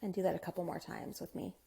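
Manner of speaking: soft, hushed meditation guide with unhurried pauses; guided meditation. Acted.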